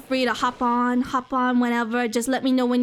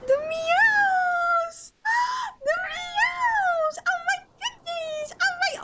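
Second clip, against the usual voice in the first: very high squealing cry of delight; slower than usual. Real, not acted. Streamer shrieking with delight at a cute creature, very high. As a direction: shriek over something adorable.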